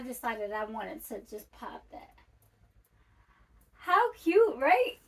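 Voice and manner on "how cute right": high, loud delighted squeal; squealing excited. Real, not acted. Showing off bag.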